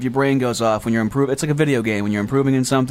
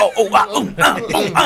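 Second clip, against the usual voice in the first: mock brawl grunts; 6 semitones higher than usual. Imitating bar brawl noises.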